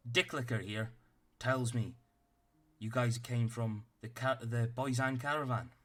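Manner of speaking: a tiny officer barking orders at the party; stern little captain. Acted.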